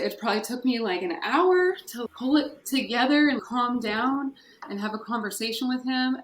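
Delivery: ordinary delivery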